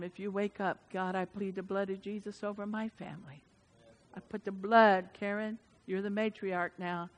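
dropped to a soft hush; quiet, intimate pastoral tone; hushed preaching. Real, not acted. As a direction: bring it down close and soft. Hushed intimate prayer section.

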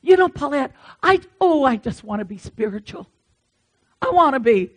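put-on pious longing of a churchgoing friend; imitating a friend. Church friend telling the preacher her wish, imitated yearning. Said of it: "she would say".

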